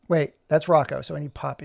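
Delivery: ordinary talk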